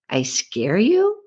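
rising pitch on "you"; slow question, lifting sharply at the end. Acted. Cowgirl repeats her date's words back, questioning. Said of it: "with a question mark".

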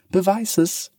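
put-on a girl daring the cat to prove it; defiant girl. Fists on hips, demanding. (trotziges Mädchen, deutlich höher)

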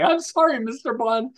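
high imitation of a receptionist cracking up; mock hysterical laughing. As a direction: squeak it out as if laughing. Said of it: "laughing hysterically".

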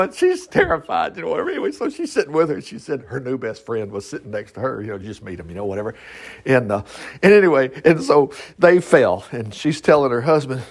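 normal talking voice

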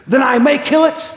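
loud question climbing high; acted eager, commanding angel; urgent demand. Angel seizes on the consent.

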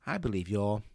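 ordinary talk, nothing marked

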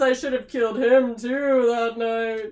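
loud hammy villain laugh and rant; megalomaniac rant. Retold or imitated. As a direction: gloat like a cartoon villain. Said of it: "villain monologue".

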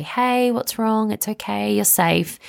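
put-on high, slow comforting to a scared child; gently soothing son in his cot; soothing mum. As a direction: reassure a frightened toddler. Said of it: "and say".

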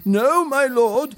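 high, drawn-out alarmed protest; blurted warning. A narrator's reading. Retainer halting the prince, high blurted warning. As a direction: stop your master, pitch jumping up. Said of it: "blurted Kawa".

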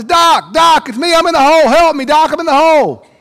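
high, loud desperate cry from a hole; yelling for help. Acted. Man stuck in a hole calls the preacher, high loud yell. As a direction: call up out of the pit.

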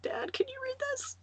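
high, small choked-up voice; mock tearful plea. Mom crying over the book asks dad, tearful squeak. Imitates herself tearfully giving up reading.

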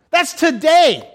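high, loud burst of emphasis; emphatic exclamation. Real, not acted. Preacher contrasting ancient naming with letters now, exclaiming emphatically. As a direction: drive it home loudly.

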